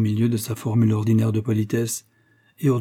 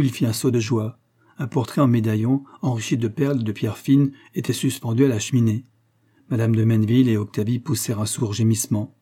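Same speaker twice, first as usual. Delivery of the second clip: ordinary delivery, nothing marked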